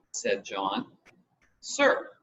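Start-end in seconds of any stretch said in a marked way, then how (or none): 1.6-2.2 smartly. A narrator's reading. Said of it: "replied Susan smartly".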